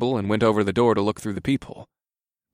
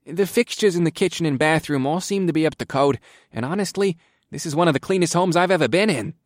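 higher-pitched, meek official voice; polite inspector; 7 semitones higher than usual. Acted. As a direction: report like a courteous little official. Inspector's held voice.

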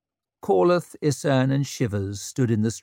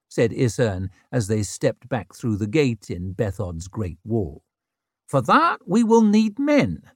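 dry lecturing; 6 semitones higher than usual. Acted. Isern lecturing.

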